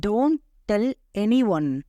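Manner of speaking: slow, word-by-word "Don't tell anyone"; slow teaching repeat. Shown as a demonstration. Teacher modelling an English phrase for learners, slowly word by word. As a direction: space out each word for learners.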